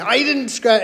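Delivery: high-pitched retold denial; mock yelling. Wife denies scratching the car, mock yelling. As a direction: imitate a heated protest of innocence. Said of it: "this yelling match".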